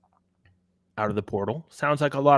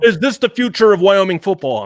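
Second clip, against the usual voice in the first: higher, louder imitation of breathless hype; mock media hype; 7 semitones higher, 9 dB louder than usual. Host mimicking media hyping up freshmen, mock excitement. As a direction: parody an overexcited pundit asking a big question.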